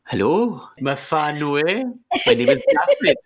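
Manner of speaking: sing-song fake Welsh, leaping high at the end; creepy bad Welsh accent. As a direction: creep everyone out in badly faked Welsh. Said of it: "creepishly putting on a bad Welsh accent".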